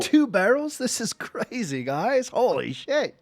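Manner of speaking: high, astonished barkeep; amazed. Acted. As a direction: gasp at the huge gift.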